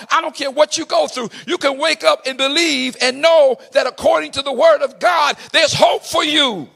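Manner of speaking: loud, high, shouted preaching; rally the church, voice up and ringing; preaching at full force. Acted. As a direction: climactic sermon shout. Preaching climax on hope.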